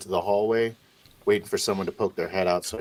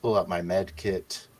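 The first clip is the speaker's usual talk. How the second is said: normal talking voice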